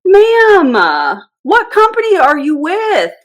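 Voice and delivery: very high, loud, drawn-out mock exasperation; exasperated demand. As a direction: stretch it out, pleading for a straight answer. Repeated question, losing patience.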